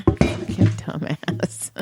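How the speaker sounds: slow, breathless, wheezing laugh-talk; laughing hard. Real, not acted. Laughing too hard at the friend's answer to get the words out. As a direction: lose it laughing mid-insult.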